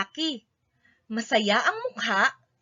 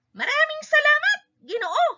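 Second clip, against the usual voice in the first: much higher, grateful chorus-like voice; villagers thanking; 13 semitones higher, 3 dB louder than usual. A narrator's reading. Villagers thanking the painter, high grateful voice. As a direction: thank him brightly, pitched way up. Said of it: "sabi ng mga taga-alikabok sa lalaki".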